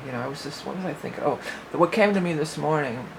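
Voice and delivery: usual speaking voice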